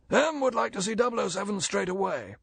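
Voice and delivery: high female voice covering a lie; desperate lie. A narrator's reading. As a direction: rush out the lie to stop him. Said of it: "Miss Moneypenny lied desperately".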